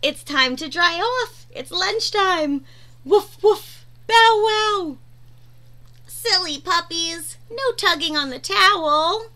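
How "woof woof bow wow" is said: puppy bark. Acted. Voicing the puppies.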